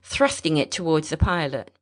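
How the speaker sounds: ordinary delivery, nothing marked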